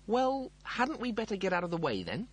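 high-pitched anxious suggestion; alarmed. Acted. Rimmer learning a planet will hit, alarmed. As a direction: ask it in rising alarm.

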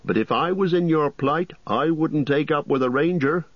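high, wary landlord voice; suspicious innkeeper. A narrator's reading. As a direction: warn them off as a distrustful innkeeper. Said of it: "looking suspiciously at Strider".

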